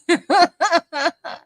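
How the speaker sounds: high, loud cackle; amused cackle. Real, not acted. Host agreeing with a viewer's jab, high cackle. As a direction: burst out cackling at the tease. Laughing at chat teasing [name].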